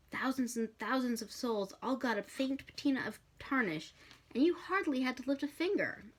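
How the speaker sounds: usual speaking voice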